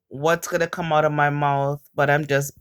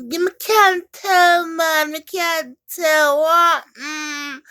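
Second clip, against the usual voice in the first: higher, slower voicing of the Jamaican man; imitating the man; 10 semitones higher, slower than usual. Retelling a Jamaican man's store chat, mimicking. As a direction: mimic him, drawn out. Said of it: "And he's like".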